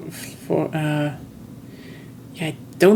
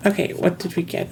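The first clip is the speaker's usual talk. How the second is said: ordinary talk, nothing marked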